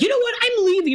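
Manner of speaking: very high, hammed-up huff; storming-out outburst. [name] quitting the argument at the house, mock furious. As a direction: squeal it like a final exit line.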